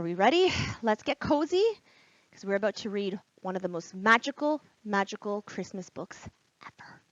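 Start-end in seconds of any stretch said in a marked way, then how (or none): 4.8-7.0 hushed excited whisper; teacher teasing the story to children, whispering. Acted. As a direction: whisper it like a secret to the kids. Building suspense for story.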